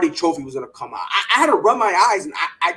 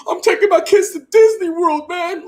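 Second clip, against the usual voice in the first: mock Dak Prescott; 9 semitones higher, 6 dB louder than usual. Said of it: "expecting Dak Prescott to be in the mic and say".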